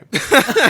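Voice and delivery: loud, high burst of laughter. Real, not acted. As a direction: crack up for real. Laughing at own story.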